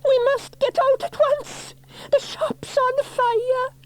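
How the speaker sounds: very high, crying plea, slowing at the end; panicked cry. A narrator's reading. Mother urging son to flee fire, high panicked cry. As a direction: wail in fright.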